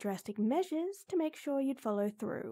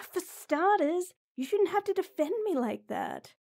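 upset scolding; 6 semitones higher, 4 dB louder than usual. Acted.

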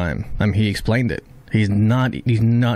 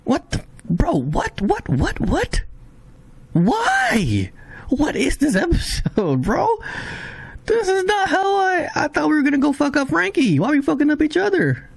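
high, stunned cries of what and why; can't believe what just happened; real shock, voice jumping high; shocked disbelief. Real, not acted. Shocked reaction to the episode.

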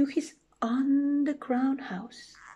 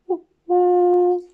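high, loud owl call; owl hoot; 9 semitones higher, 9 dB louder than usual. Acted. Gruffalo hears a hoot in the trees, high loud hooting.

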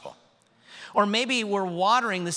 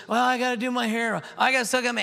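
put-on whiny kid excuses; 7 semitones higher, 4 dB louder than usual. Kids making excuses, voiced.

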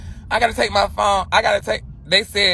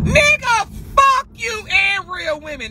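imagined angry retort; 10 semitones higher, slower than usual. Retold or imitated. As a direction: yell the comeback she held back. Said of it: "that's what I wanted to say".

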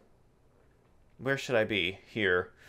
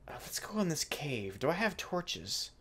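hushed whisper, sneaking in; 11 dB quieter than usual. Real, not acted. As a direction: whisper so nothing hears you. Sneaking into a cave.

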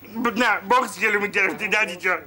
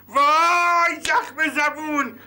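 high, drawn-out gasp of outrage; shocked exclamation; 6 semitones higher, much slower than usual. Acted. Neighbour reacts to the bride's complaint with slow, exaggerated shock. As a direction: play up the horror slowly.

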